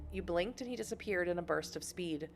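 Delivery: usual speaking voice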